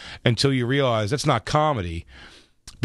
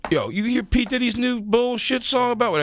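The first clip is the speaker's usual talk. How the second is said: much higher, hyped street-style opener; pandering club comic; 12 semitones higher than usual. Retold or imitated. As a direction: pump up the crowd like a hip hop club host. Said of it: "I had pandered my set so much".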